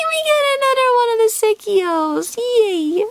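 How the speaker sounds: very high celebratory cry; real high-pitched excitement; thrilled squeal. Real, not acted. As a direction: squeal with delight at a lucky pull. Celebrates another favourite card.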